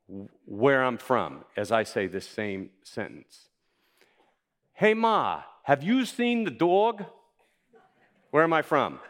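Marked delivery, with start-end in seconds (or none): 4.7-7.1 high, loud Boston-style accent, dropping the r in "Ma"; "Hey, Ma" said high and loud in a thick East Coast accent; regional accent. Shown as a demonstration. Preacher shows the church a regional accent. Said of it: "I'm gonna say it in different regional dialects".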